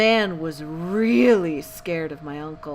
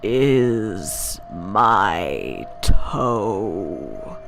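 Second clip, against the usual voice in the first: much lower, very slow moan sinking down; deep groaning ghost; 10 semitones lower, 3 dB louder, much slower than usual. Acted. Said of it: "it groaned".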